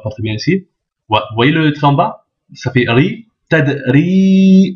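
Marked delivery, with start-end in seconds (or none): 3.5-4.7 "ri" with a long held i; prolonged vowel. Shown as a demonstration. Teacher pointing out the prolongation, long held i in "ri". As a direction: say "ted", then stretch "ri". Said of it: "il y a la prolongation".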